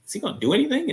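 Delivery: high mock-doubting voice; bored, unimpressed viewer. Viewer watching Andy at first, doubtful and mocking. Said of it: "you're like".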